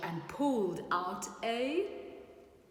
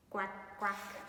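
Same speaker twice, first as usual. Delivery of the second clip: quack; 6 dB quieter than usual. Acted. Recalling duck noise.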